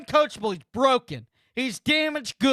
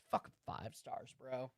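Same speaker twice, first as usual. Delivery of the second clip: hissed under the breath, much softer; disgusted mutter; 12 semitones lower, 20 dB quieter than usual. Real, not acted. Streamer muttering about the five-star player, hushed and disgusted.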